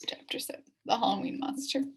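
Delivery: ordinary delivery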